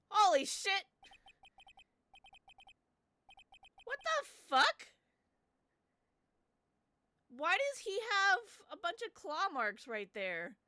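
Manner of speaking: high, loud stunned shout; shocked yell. Real, not acted. Shocked by the reveal.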